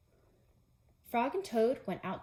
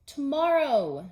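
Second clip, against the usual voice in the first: slow, louder drawn-out tomorrow; louder, slowed whine of tomorrow; 5 semitones higher, 8 dB louder, slower than usual. Acted. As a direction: stretch it out lazily. Toad stalling.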